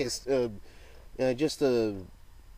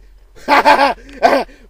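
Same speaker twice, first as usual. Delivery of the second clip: loud laugh; 13 semitones higher, 18 dB louder than usual. Real, not acted. After shouted challenge.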